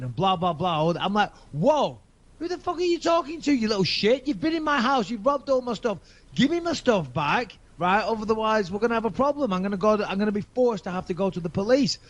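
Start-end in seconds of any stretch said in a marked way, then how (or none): 1.5-12.0 heated, high-pitched telling-off; reenacted angry rant at the fake lawyer; angry retort. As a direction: tear into him over the phone. Angry phone retort to thief's friend.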